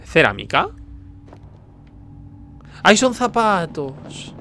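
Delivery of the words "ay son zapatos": high, stretched-out exclamation; amazed realisation. Real, not acted. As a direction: draw the words out in high surprise. Surprised discovery of shoes. (sorpresa aguda y alargada)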